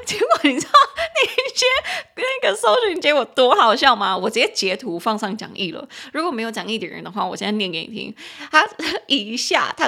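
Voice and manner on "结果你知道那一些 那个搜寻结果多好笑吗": laughing, much higher and louder; can barely finish for laughing. Real, not acted. (边笑边说多好笑)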